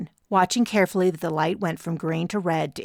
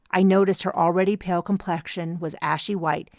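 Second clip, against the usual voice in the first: ordinary delivery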